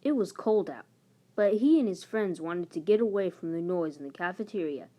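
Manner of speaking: usual speaking voice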